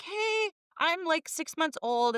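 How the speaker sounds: put-on high, drawn-out little baby greeting; baby's voice. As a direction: speak up sweetly for a six-month-old. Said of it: "from the baby that was like".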